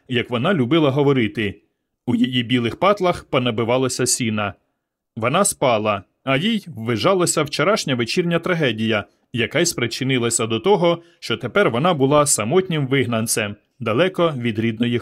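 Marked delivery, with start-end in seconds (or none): none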